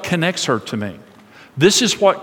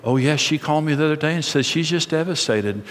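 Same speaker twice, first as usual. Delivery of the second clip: gossiping women; 3 semitones lower, 5 dB quieter than usual. Retold or imitated. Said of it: "they're saying".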